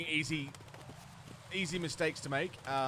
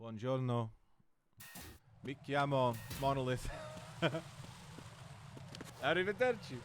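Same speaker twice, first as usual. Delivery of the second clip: rusty Italian; 3 semitones lower, 4 dB quieter than usual. Real, not acted. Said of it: "how much Italian I remember".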